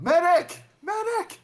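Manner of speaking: shouted high and loud for a medic; man down shout. Acted. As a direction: yell across the battlefield for help. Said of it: "I call, I yell out".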